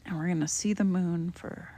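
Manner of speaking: usual speaking voice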